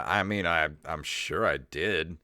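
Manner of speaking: normal talking voice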